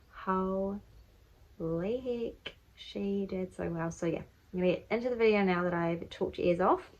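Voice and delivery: ordinary talk, nothing marked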